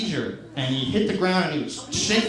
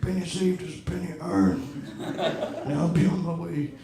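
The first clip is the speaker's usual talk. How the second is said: wise sage; 5 dB quieter than usual. Pretend. Imagined wise homeless man.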